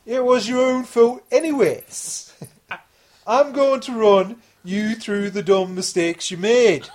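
put-on high, loud, slow English accent, laughing at the end; cocky teen thief in English accent. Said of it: "Sorry, English accent".

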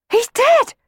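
high, loud shocked cry; cry of alarm. Acted. Twins seeing Sammy fall, crying out in alarm. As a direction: shriek it in fright. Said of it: "cried the twins".

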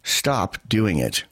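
ordinary talk

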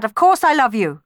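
loud, high, urgent declaration; cried out. A narrator's reading. Orpheus to doubting Eurydice, loud urgent cry. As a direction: cry it out to convince her. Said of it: "cried Orpheus".

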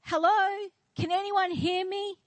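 high mock holler into the distance; hammed-up, high-pitched call for rescue; acted cry for help. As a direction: call out for anyone within earshot. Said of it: "he was yelling and crying for help".

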